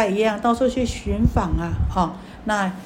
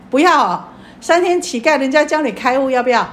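rousing call to audience; 4 semitones higher, 6 dB louder than usual. Real, not acted. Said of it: "preacher rousing the audience".

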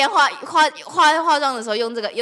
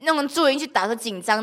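ordinary delivery

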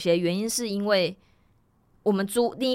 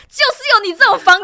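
much higher and louder, indignant ranting; furious inner rant; 13 semitones higher, 12 dB louder than usual. Retold or imitated. Voicing what she thought of the landlady: loud furious rant. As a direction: let the outrage burst out.

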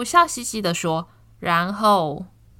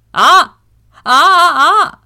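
higher, louder startled cries; sudden loud cry; 8 semitones higher, 12 dB louder, slower than usual. Acted.

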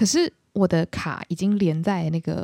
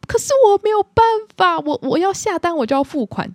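desperate; 12 semitones higher, 5 dB louder than usual. Retold or imitated. Desperate reply to customer service.

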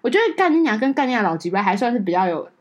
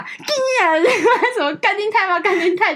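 laughing curse; 10 semitones higher than usual. Real, not acted. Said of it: "干跟偶尔的干你娘".

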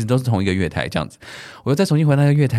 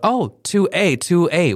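slow, higher mock attendant voice; lightly imitated railway attendant; mimicking the attendant; 7 semitones higher, much slower than usual. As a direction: drawl it like the station worker. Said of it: "听到他又在跟人讲说".

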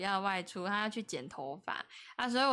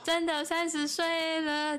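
drawn-out, higher mock lament; stretched-out, hammed-up dismay; mock despair; 6 semitones higher, slower than usual. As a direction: wail it in playful doom. Mock despair at turning thirty.

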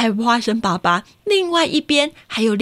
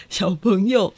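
usual speaking voice

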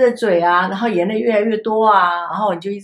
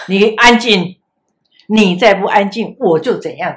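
put-on loud stern threat to a crying child; an exasperated teacher yelling at a wailing kid; threatening scold; 9 dB louder than usual. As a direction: bark the warning louder and louder over the crying.

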